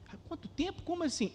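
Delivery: high, puzzled mock woman's voice; surprised woman voice. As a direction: play the startled churchgoer caught off guard. Woman voiced in retold dialogue.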